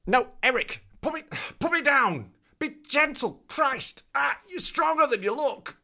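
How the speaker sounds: high, strained protesting cries; squeezed panic. Acted. Reporter begging Eric to put him down, high and strained. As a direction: yelp as if being crushed in a hug. Being crushed in a hug.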